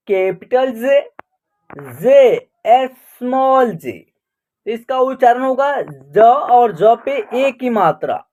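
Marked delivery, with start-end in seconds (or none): none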